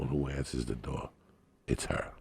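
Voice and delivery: ordinary talk, nothing marked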